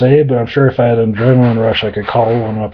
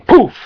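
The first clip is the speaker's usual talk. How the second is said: summoning poof; 14 semitones higher, 5 dB louder than usual. Acted.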